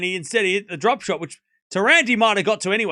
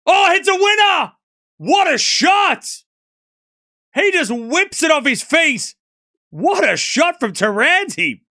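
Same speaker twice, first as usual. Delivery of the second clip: much higher and louder, stretched out in delight; excited outburst; 10 semitones higher, 7 dB louder, slower than usual. Real, not acted. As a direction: erupt at a stunning winner. Great winner, commentator erupts.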